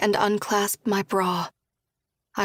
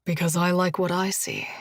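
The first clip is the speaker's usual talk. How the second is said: lightly lowered Josh voice; 2 semitones lower than usual. Acted. Josh asks Julia gently, lightly voiced man.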